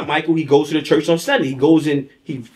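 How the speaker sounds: ordinary talk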